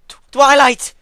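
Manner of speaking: terrified scream. A narrator's reading. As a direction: scream her name in terror. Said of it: "she screamed loudly".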